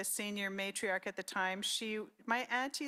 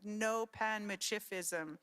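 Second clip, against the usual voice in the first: ordinary talk, nothing marked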